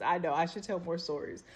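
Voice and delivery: ordinary delivery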